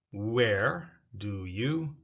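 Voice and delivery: "Where do you" said slowly, "you" full; slow full form. Shown as a demonstration. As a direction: spell out every word of "Where do you". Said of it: "Where do you becomes where do ya".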